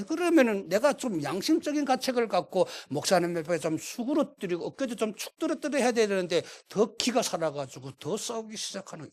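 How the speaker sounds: usual speaking voice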